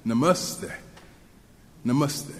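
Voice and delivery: Namaste as "nuh-MUS-theh", middle syllable stressed; correct pronunciation. Shown as a demonstration. Speaker practising before the congregation, careful middle-stressed Namaste. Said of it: "So the word is correctly pronounced".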